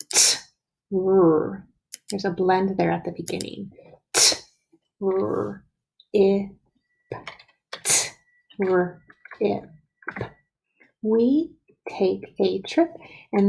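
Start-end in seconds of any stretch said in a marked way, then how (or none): 0.1-1.7 put-on each sound pulled apart slowly for kids. Shown as a demonstration. Teacher sounding out trip for young writers, slow and separated. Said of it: "listen carefully as you hear me say it slowly". 4.1-9.7 put-on each sound pulled apart slowly for kids. Shown as a demonstration. Teacher sounding out trip for young writers, slow and separated. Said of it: "listen carefully as you hear me say it slowly".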